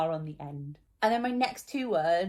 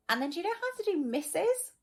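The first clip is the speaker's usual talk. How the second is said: much higher, quick coaxing ask; encouraging question; 11 semitones higher, faster than usual. Real, not acted. Teacher coaxing a pupil to read missus, voice pitched high.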